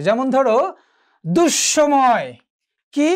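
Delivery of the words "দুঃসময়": দুঃসময় drawn out slowly with a double s; doubled consonant. Shown as a demonstration. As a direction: hold the স in দুঃসময় as "dush-shomoy". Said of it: "দ্বিত্ব হয়ে যাবে". (দুঃসময়-এ স-এর দ্বিত্ব, টেনে উচ্চারণ)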